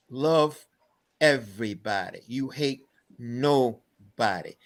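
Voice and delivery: slow, spaced-out, weighty words; grandfather's slow lesson. Retold or imitated. Grandfather teaching his grandson about life, slow and deliberate. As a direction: leave room between the words, deliberately.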